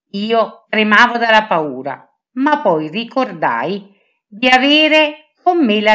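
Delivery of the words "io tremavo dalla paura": ordinary delivery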